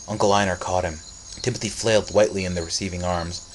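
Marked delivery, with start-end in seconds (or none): none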